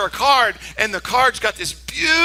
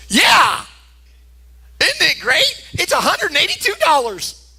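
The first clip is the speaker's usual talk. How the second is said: proud delight; 9 semitones higher than usual. Retold or imitated. Boyfriend proud of his cash gift, thrilled. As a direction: burst out high, hugely pleased with yourself. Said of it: "thought it was the greatest thing".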